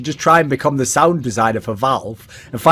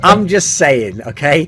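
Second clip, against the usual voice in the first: usual speaking voice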